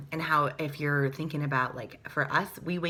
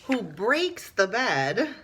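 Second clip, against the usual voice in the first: climax excitement; 3 semitones higher, slower than usual. A narrator's reading. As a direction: hit the big crash of the story.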